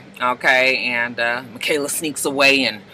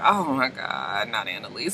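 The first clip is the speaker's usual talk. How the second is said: groaning dismay; 8 semitones lower, 10 dB quieter than usual. Retold or imitated. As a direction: sink into dread, barely audible. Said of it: "Michaela sees her like".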